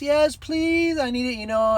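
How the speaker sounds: high-pitched, imitated flustered plea; embarrassed young woman. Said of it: "she's feeling embarrassed, so she's kinda laughing".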